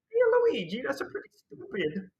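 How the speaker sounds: very high, loud cartoon Mario squeal; Mario impression. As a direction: yelp it like a goofy Mario. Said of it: "even I can do better Mario impressions".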